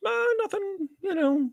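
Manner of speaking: very high, soft mimicking voice; imitated dismissive client. Client shrugging off the reader's question, imitated dismissively. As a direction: brush it off in a tiny high voice.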